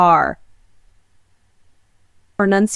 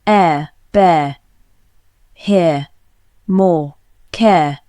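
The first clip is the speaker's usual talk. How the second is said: British accent, no final R in "air, bear, here, more, care"; British accent: drop the R, end open on the vowel; British accent R-less demo; 4 semitones lower, much slower than usual. Shown as a demonstration. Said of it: "But in British English, we say".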